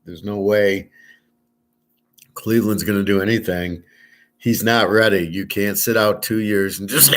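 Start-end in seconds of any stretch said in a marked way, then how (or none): none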